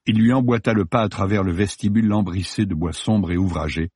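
ordinary delivery

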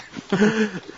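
quick amused laugh, pitched high; a light chuckle after the joke; short laugh. Acted. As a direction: laugh off your own quip. Laughs at his own joke.

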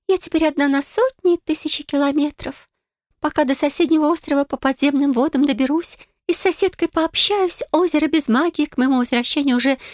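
high, wistful mermaid voice; a lonely mermaid remembering old days. Acted. Mermaid laments her loneliness.

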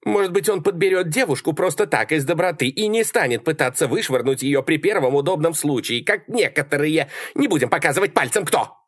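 high, animated female voice; high-pitched indignant jab; taunting Sally. A narrator's reading. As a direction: needle him pointedly from afar. Sally's pointed taunt from afar.

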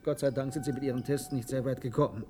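ordinary talk

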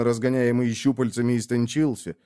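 ordinary talk

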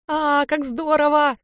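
high, drawn-out squeal of delight; gushing high-pitched thrill. A narrator's reading. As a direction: squeal it slowly with sheer joy. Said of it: "визжала мне на ухо Аня".

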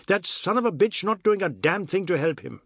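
high, loud outraged cry; screaming. Acted. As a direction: shout the complaint out. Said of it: "he was screaming".

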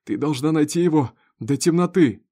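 loud, high, pleading order; urgent demand. A narrator's reading. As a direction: urge her to hurry. Injured father urges wife. (громко, требовательно)

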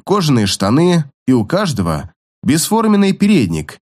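usual speaking voice

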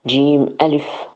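normal talking voice

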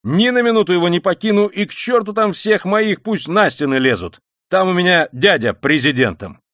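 loud, high, emphatic vow; heated outburst. A narrator's reading. (горячо, громко, с напором)

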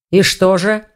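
ordinary delivery, nothing marked

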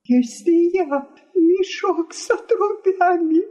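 high, choked outburst; acted wail through tears; tearful cry. A narrator's reading. Wife hurt by husband's insult, crying out tearfully. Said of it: "захлёбываясь от слёз, вскричала жена".

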